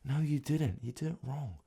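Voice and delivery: a hint of a scolding guitar teacher; mock teacher voice. Guitar teacher playfully scolding himself as [name]. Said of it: "[name] the guitar guy".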